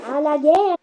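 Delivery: high, loud rallying cry; rally the troops; war cry. Acted. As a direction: lord calls his army to war, shouting.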